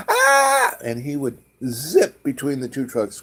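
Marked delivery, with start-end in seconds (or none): 0.1-0.8 high, sudden yelp of fright; startled scream. Retold or imitated. Passengers shriek as the limo squeezes between trucks. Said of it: "everybody would be like".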